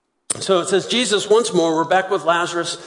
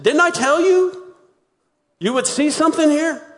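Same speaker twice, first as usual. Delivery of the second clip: high rebuke; 11 semitones higher, 3 dB louder than usual. Retold or imitated. Preacher voicing Jesus' reply.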